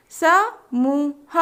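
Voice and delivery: समूह stretched very slowly; slow drawn-out word. Shown as a demonstration. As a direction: draw out समूह syllable by syllable. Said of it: "तीन वर्ण वाले शब्द".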